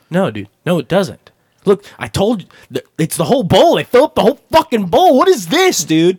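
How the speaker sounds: loud, high rant climbing in outrage; angry friend. Retold or imitated. Friend fuming over his skimpy salad, angry retold rant. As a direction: retell his outburst, pitch and volume rising. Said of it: "starts getting angrier".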